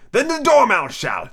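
high, slow theatrical cry; hammed-up joint outcry. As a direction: call it out high and drawn-out. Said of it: "they both cried".

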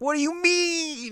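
high, slow hurt question; high disbelieving anime girl. Acted. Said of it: "And I was like".

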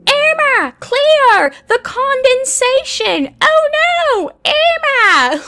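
shrieky, high, loud Australian imitation; attempted Australian accent. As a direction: squeal it like a panicked Australian mermaid. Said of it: "hear me try and do an Australian accent".

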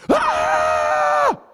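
piercing high scream; blood-curdling scream. Retold or imitated. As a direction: shriek like labor just hit. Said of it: "I hear this blood-curdling scream".

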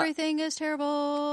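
playfully chanted doom; playful sing-song. Pretend. Co-host playfully echoes the line.

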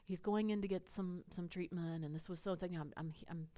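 very soft, gentle retold apology; sweet apology. Woman apologising to a stranger about her son, sweet and soft. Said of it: "she's very sweet".

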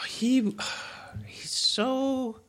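very high, slow swooning; dreamy gushing. Acted. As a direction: melt over your boyfriend. Girlfriend gushing about Sonic.

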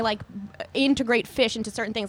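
ordinary delivery

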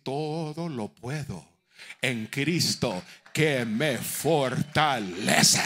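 slow, swelling, final words loud; proclaimed verse. Acted. Preacher proclaims scripture to the brethren, slow and rising. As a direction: declare the verse with conviction.

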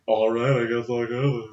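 low-key, put-on resigned voice; mock dejection. Imitating the man told to fuck off, subdued resignation. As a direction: give in with a subdued mock sulk. Repeats the rebuffed man giving in.

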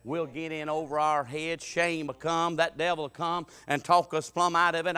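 normal talking voice

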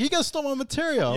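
high-pitched imitated complaint; mock indignant accusation. Friend voices Ruben accusing the church, high mock outrage. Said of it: "Ruben's like".

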